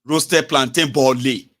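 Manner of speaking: slow, loud, pointed repetition; loud, drawn-out acted disbelief; mock incredulous echo. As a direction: throw the words back slowly and loudly. Seller echoes the affected customer.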